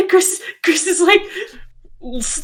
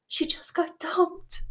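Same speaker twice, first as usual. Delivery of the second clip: slow, unvoiced whisper; whispering over the crying girl's head; 5 semitones higher, 8 dB quieter, slower than usual. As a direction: whisper it so she can't hear. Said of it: "she whispers to Leon".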